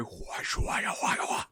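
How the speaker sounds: whispered, spooky ghost wailing; scary ghost voice. Retold or imitated. Host possessed by the ghost at the very end, scary ghost voice. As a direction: imitate a ghost in an eerie whisper. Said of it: "ghost voice".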